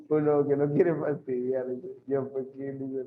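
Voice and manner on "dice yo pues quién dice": ordinary talk, nothing marked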